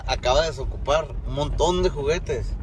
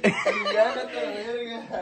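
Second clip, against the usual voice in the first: real laugh; 7 semitones higher, 9 dB quieter than usual. Real, not acted. Laughing as his friend gets soaked.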